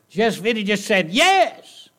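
high, loud, drawn-out exasperated plea; exasperated cry. Acted. Preacher to congregation, pleading in exasperation. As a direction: throw up your hands, begging him to say yes. Preacher mocks the man's excuse.

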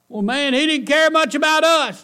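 high, loud whining complaint; mock complaint. Preacher voicing doubters about Jesus, whining high. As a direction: whine like a grumbling doubter.

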